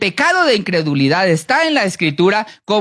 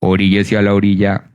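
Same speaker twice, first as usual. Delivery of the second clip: much lower, firm officer command; traffic cop order; 12 semitones lower than usual. Retold or imitated. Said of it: "el de tránsito le dice".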